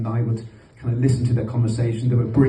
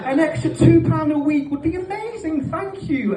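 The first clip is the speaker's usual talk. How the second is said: cheeky fake gratitude. Boy cheekily thanking his dad, high mock gratitude. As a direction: overplay the grateful child. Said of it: "I was like".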